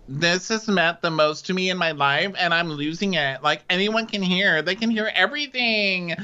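retold upset plea, high-pitched and mounting; mock distressed protest. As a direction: act out someone upset, pitch climbing. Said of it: "Kenya's upset".

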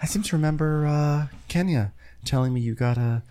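hesitant, put-on subdued voice; mock passive-aggressive castmate. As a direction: imitate someone politely stewing. Continuing Cynthia's hesitant disapproval.

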